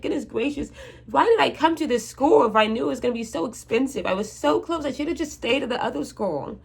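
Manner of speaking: lightly voiced groan at her own choice; exasperated inner voice. Talking to herself about the school, a touch exasperated. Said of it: "And I'm just like".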